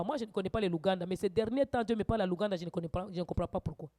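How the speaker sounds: ordinary talk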